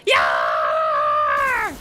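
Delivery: deep far-off yell; shout. Acted. Streamer mimicking a game character, booming shout. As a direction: bellow it out.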